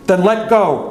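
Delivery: high, desperate shouted order; screaming. Retold or imitated. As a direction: scream it as a last command. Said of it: "Chick screamed".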